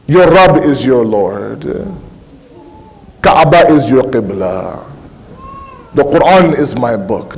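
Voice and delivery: feed each answer line by line, deliberately; grave, measured recital of answers; coaching the answers. Acted. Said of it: "just say this".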